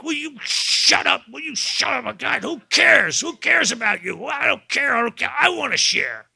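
loud, high, exasperated snapping at the group; irritated outburst. Retold or imitated. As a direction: blurt it out furious at someone sharing. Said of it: "I'd be like".

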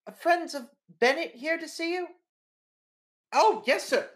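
high, loud, eager secretary voice; receptionist on the phone. Acted. Receptionist calling the editor about visitors, high eager voice. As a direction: answer the boss brightly and eagerly. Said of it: "into the phone and goes".

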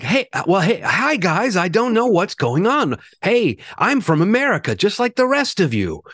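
exaggerated American accent, high, swooping and drawn out; Ewan McGregor's American accent. Mocking an actor's attempt at an accent, hammed-up American. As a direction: put on an overdone, high-pitched American accent. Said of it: "Ewan McGregor's American accent".

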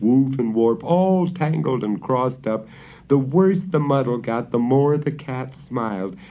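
plain reading voice

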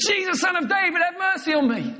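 desperate shout. Acted. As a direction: call out across the crowd. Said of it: "He shouted all the more".

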